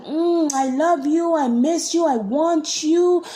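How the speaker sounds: high, loud mock yearning; imitated lovesick voice. Imitating her possessive declarations of love, high mock yearning. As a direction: croon it high, dragging out the last word. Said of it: "She's like".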